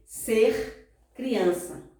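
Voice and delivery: "Ser criança" very slow, the R of "Ser" drawn out; careful R. Shown as a demonstration. Teacher modelling for pupils, careful R. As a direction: stretch "Ser" onto a clear R before "criança". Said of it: "pronúncia correta do R".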